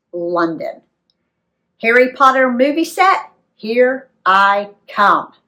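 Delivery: ordinary delivery, nothing marked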